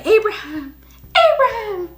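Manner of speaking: high, sing-song call of the name Abraham; playful mock angel calling Abraham, way up high; silly high call. Shown as a demonstration. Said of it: "I don't think that's how he said it".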